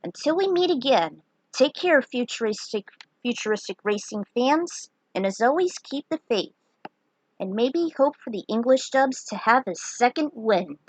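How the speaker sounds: plain reading voice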